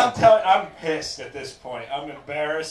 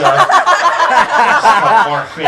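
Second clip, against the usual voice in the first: higher, much louder, slower coax; drawn-out coaxing; 8 semitones higher, 17 dB louder, slower than usual. Retold or imitated. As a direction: stretch it out with a push. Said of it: "dry humping me the whole time, like".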